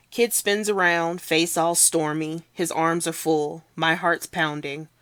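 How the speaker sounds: plain reading voice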